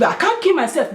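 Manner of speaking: ordinary talk, nothing marked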